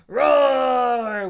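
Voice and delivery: loud, held dinosaur roar; big roar for children; playful roar. A narrator's reading. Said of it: "went Froggy".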